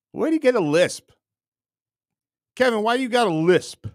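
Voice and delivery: high, loud mock disbelief; mock incredulous. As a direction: tease him with exaggerated surprise. Mock questioning Kevin.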